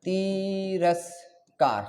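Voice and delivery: तिरस्कार syllable by syllable, slowly; slow sounding-out. Shown as a demonstration. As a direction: stretch तिरस्कार into tee... ras... kaar. Teacher sounds the word out slowly.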